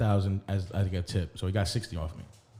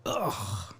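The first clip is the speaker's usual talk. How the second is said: groan; 2 semitones lower, 8 dB quieter than usual. Real, not acted. Groan after taking a drink.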